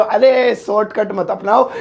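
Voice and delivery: ordinary delivery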